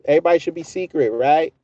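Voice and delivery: ordinary talk, nothing marked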